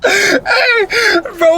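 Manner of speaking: usual speaking voice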